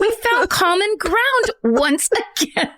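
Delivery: high, slow, through laughter; laughing. Real, not acted. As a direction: squeal it out while cracking up. Laughing at shared compliment.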